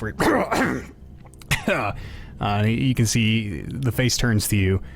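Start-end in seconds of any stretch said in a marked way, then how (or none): 1.8-2.4 zombie clearing its throat; throaty undead cough; human-sounding cough. Acted. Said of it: "gives this very human-sounding cough".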